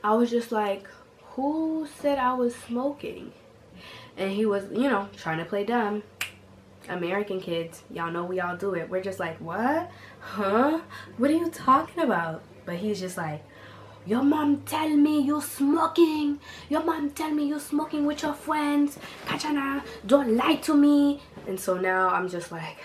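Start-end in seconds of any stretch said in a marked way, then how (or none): 1.3-3.3 playing dumb. 9.4-12.4 playing dumb. 14.1-21.2 high, mock Haitian accent, scolding; imitating Haitian dad. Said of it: "my Haitian father".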